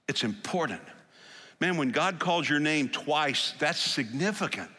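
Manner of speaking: ordinary talk, nothing marked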